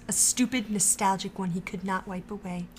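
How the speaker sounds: usual speaking voice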